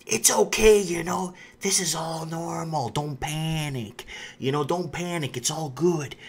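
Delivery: soft, slow, calming media voice; mock reassuring news anchor; soothing mock reassurance. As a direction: hush them like a smooth spokesman saying all is fine. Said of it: "You see the article saying".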